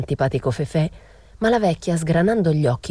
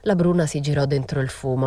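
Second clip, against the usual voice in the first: ordinary delivery, nothing marked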